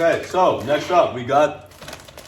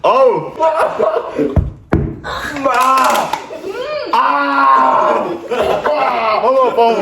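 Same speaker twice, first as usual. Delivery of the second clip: loud pained cries through sour candy; mouth full of sour candy; 9 semitones higher, 5 dB louder, much slower than usual. Real, not acted. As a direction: yelp around a mouthful of warheads. Six warheads in mouth.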